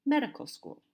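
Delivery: medical school with MED high and loud; first-syllable stress. Shown as a demonstration. Teacher repeats the correct way, medical school stressed on MED. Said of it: "The stress is on the first syllable".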